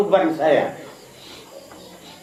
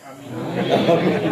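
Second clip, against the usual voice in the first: laughter; 6 dB quieter than usual. Real, not acted. Congregation laughs after the prayer.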